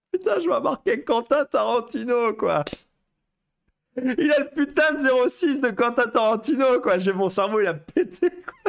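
high, incredulous excitement on the edge of laughter; giddy amazement. Retold or imitated. Telling of seeing Tarantino's number, giddy amazement. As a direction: gush in disbelief, high-pitched.

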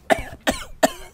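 high sputtering engine cough. Acted. The engine coughing as Len cranks it, sputtering. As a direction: cough like an old engine coming to life. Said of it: "It gave a loud cough".

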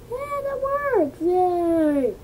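high, drawn-out celebration; excited cheer. Real, not acted. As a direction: cheer long and high as it works. Celebrates the drawing working out.

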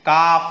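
"काफ" as kaaf, loud and clear, no l; silent l in calf. Shown as a demonstration. Teacher calls "काफ" to the class, l silent. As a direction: call out "काफ" with no l sound. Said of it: "एल is silent".

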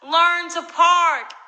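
high, slow, booming yell; drawn-out bellow of the note; all-caps shout. Retold or imitated. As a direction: read the note as if every letter is capital. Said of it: "in all caps".

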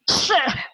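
staged "achoo" sneeze; demonstrated sneeze. Shown as a demonstration. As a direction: fake a short sneeze. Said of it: "that is sneezing".

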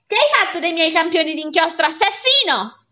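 loud, high accusation; acted enraged salesman; furious shout. A narrator's reading. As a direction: yell in outrage at the culprit. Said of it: "ha gridato".

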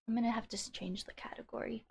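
unvoiced whisper to self. Real, not acted. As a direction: whisper it under your breath. Muttering to self.

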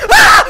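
very loud, high shriek; sudden piercing cry of fright; terrified scream. Real, not acted. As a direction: scream full out at a jump scare. Huge jump scare scream.

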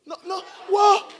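high, slowed "no, no, what?"; surprised protest. Real, not acted. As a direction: caught off guard, denying it. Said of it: "You were shouting".

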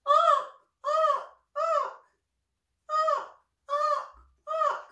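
repeated high, loud raven honks; a lost chick squawking for help; crying baby raven. Acted. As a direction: cry out like a fallen baby raven. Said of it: "All Mac could do was cry".

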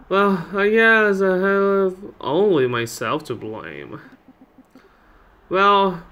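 put-on old lady blaming herself; resigned old lady. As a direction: play an old woman accepting her fate. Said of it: "it's an old lady".

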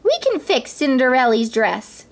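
rally the mice to help; eager mice. A narrator's reading. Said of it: "cried the mice".

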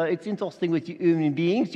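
normal talking voice

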